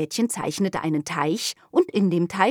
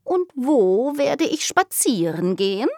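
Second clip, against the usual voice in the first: kitten voice; 7 semitones higher, 4 dB louder than usual. Acted. Kitten asks.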